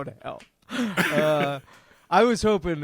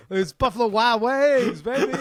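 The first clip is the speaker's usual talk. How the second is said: high, loud catchphrase whoop; mock hype; 6 semitones higher, 5 dB louder than usual. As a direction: crow it like a hype man. Hyping the joke nickname.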